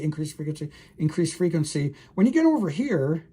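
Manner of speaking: ordinary talk, nothing marked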